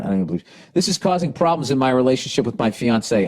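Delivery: ordinary talk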